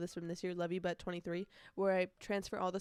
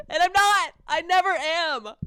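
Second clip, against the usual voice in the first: louder, higher protest; exasperated; 9 semitones higher, 12 dB louder, slower than usual. Real, not acted. Podcaster complaining she never loses her voice, louder. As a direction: blurt it out, playfully loud.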